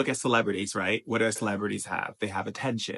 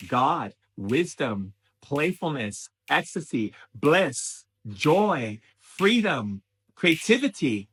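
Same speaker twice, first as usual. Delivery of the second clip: building excitement; 4 semitones higher, 6 dB louder, slower than usual. Real, not acted. List of uplifting values, building.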